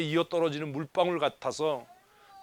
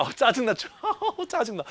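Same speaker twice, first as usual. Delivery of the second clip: mock irritation broken by a high laugh; imitating someone who laughs while complaining; laughing 짜증나; 4 semitones higher than usual. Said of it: "짜증나 하면서 웃는 사람이 있어요".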